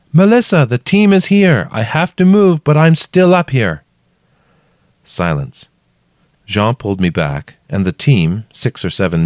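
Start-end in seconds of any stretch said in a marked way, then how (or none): 0.1-3.8 high, loud shouted message; calling down. A narrator's reading. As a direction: shout down so she knows you remain. Narrator shouting down reassurance.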